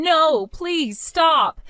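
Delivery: high, drawn-out pleading cry; pitiful shouting. A narrator's reading. Narrator voicing panicked husband fleeing the men, pitiful shouting. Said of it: "He was shouting".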